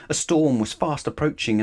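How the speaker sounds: plain reading voice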